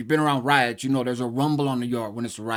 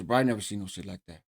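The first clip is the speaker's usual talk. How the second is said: ordinary delivery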